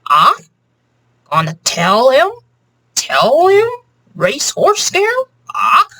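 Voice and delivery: playful parrot impression. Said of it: "I don't know if that's a good impression of a parrot".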